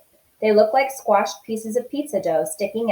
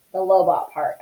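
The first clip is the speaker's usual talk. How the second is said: usual speaking voice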